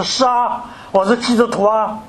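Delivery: emphatically loud reply. Retold or imitated. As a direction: answer up high, proudly out loud, without shouting. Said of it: "我就特别大声的讲".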